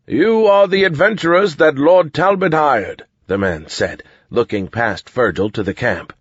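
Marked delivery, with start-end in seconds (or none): 0.1-3.1 higher, formal soldier voice; soldier herald. A narrator's reading. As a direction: address them as a cautious soldier. Soldier addressing adventurers.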